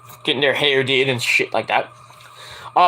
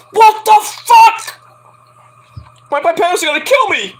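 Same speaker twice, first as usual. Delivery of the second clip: shocked outburst; 14 semitones higher, 6 dB louder than usual. Retold or imitated. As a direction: yelp in horror at the haircut. Sees his shaved head in shock.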